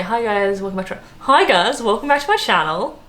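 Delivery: put-on Australian vlog greeting, rising lilt; Australian accent. Shown as a demonstration. Vlogger demonstrating own video intro, exaggerated Australian accent. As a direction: play up the Aussie vowels and upward swing. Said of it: "That's, that's an Australian accent".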